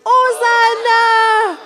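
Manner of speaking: long, high, loud cheer; shouted hosanna. Shown as a demonstration. Teacher leading the crowd's cry for Jesus, long shout. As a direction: stretch it out at full shout. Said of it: "alors on crie".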